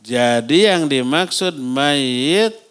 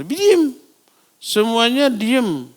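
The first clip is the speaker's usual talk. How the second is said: stern hush; 7 semitones higher, slower than usual. Acted. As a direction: silence the crowd with a stern order. Ordering the mourners to be silent.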